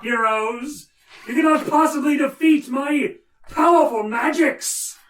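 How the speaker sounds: high shouted villain taunt; slow theatrical villain menace. Acted. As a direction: gloat loudly at the heroes. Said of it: "He is going to cackle out".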